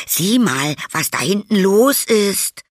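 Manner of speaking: high, loud cry drawn out at the end; sudden calling out. A narrator's reading. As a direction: call urgent attention to something behind. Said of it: "rief Tom plötzlich". (aufgeregt und hoch rufend)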